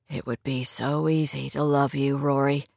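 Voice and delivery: low, soft declaration; tender male lead. Acted. Man declares love tenderly.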